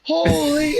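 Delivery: very high, loud cry of shock; shocked exclamation. Retold or imitated. Advisor freaking out at the buyer's name, a loud high cry. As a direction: shriek it like you've seen a ghost. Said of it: "He's like".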